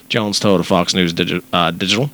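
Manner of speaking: ordinary talk, nothing marked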